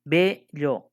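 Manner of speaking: Bello slowly, BE held long; stress on BE. Shown as a demonstration. Teacher showing where Bello is stressed, long held BE. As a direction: stretch the E in Bello, then a separate llo. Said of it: "es la E".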